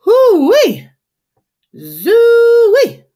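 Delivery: very high, loud drawn-out squeal; pig squeal. A narrator's reading. Blossom the pig sees the mess, pig squeal. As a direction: shriek like a startled pig. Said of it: "Blossom squeals".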